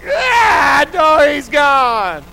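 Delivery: high, loud, slow wailing cry; wailing toddler. Retold or imitated. Three-year-old watching the fish flushed, loud crying wail.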